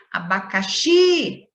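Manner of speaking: "Abacaxi" slow, with the final XI held long and strong; stress on final XI. Shown as a demonstration. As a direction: hit and stretch the last syllable of "Abacaxi". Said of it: "eu circulei a última sílaba". ("Abacaxi" com o XI final forte e alongado)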